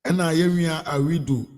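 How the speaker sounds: ordinary talk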